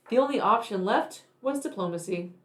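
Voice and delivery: ordinary delivery, nothing marked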